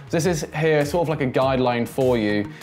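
ordinary delivery

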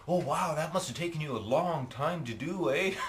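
high, put-on amazed voice; impressed onlookers. People marvelling at how long the painting took, mock amazement. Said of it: "and people go".

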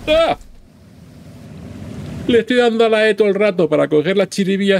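high, loud frantic yell; panicked. Real, not acted. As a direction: panic while trapped. Trapped in steam, frantic.

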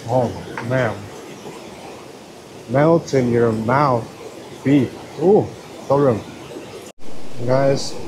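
ordinary talk

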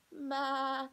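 high, loud bleating Ma; lamb cry. Acted. Lamb seeing his mother, high loud bleat. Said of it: "cried Lamb".

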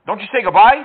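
ordinary delivery

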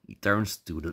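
ordinary talk

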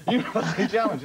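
very high, tiny announcer voice; wee hamster. Acted. Comic revealing the wee man behind the voice, hamster squeak. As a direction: squeak out the show title like a mouse that roared. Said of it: "It was like a wee hamster".

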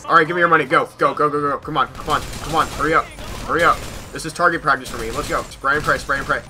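normal talking voice

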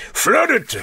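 high, sudden cry of God; hammed-up divine order; mock God's command. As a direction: call it out high like a cartoon God. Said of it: "And God says".

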